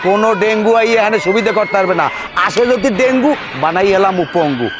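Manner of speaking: high-pitched loud villain boast ending in laughter; gloating villain. Acted. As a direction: taunt your captives, hammed-up and high. Villain taunts the captives. (খলনায়কের চড়া গলার দম্ভ)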